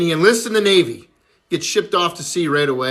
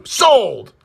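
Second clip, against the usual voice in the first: loud, higher exclamation; exasperated giving in; 5 semitones higher, 5 dB louder than usual. Acted. Lover caves to the boy's price, exasperated. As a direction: blurt it out in exasperation.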